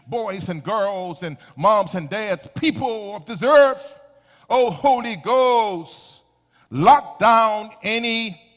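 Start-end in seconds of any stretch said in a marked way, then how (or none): 2.5-8.4 slow, loud, stretched-out plea; fervent prayer. Real, not acted. Preacher calling on the Holy Ghost to lock down evil, fervent prayer. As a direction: raise the prayer into a drawn-out cry.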